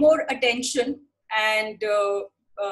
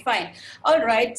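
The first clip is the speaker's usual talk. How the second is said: usual speaking voice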